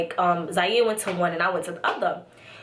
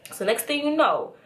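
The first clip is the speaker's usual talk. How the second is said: usual speaking voice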